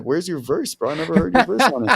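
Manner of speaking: high-pitched, mock-incredulous questioning; playfully retold demand, voice jumping high; retold incredulous challenge. Acted confrontation with Bands over missing verse.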